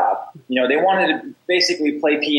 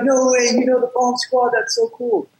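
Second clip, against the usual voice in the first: put-on much higher, amazed young guy voice; starstruck young student; 9 semitones higher than usual. The student Nate reacting to the Bomb Squad, higher and amazed. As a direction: squeal it like a kid who can't believe it. And he was like.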